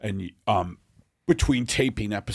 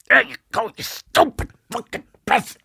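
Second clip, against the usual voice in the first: high, fast and loud, yelling at the bird; angry chef voice; 13 semitones higher, 5 dB louder, faster than usual. Retold or imitated. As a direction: snap at the pheasant in a shrill rage. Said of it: "And they go".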